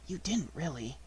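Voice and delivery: soft whisper of comfort; hushed, comforting aside. A narrator's reading. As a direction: whisper it gently to a friend. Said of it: "whispered Piglet to Pooh".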